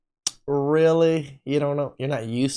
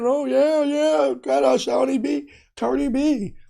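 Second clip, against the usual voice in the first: excited; 11 semitones higher than usual. Real, not acted. Hyping [name]'s new man.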